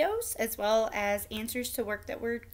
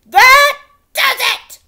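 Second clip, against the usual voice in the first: much higher, louder, slow furious outburst; steaming knight; 13 semitones higher, 17 dB louder, slower than usual. A narrator's reading. Knight furious at the prince's pranks, shouting high. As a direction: explode like an insulted knight. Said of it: "steamed Sir Bedwetter".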